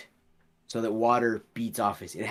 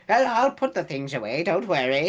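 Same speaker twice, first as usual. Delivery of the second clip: higher, louder, quick and eager voice; Randy; 9 semitones higher, 6 dB louder, faster than usual. Acted. Helper telling the rushing player he'll tidy up, quick eager higher voice. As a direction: rattle it off fast and eager to help. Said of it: "Randy goes".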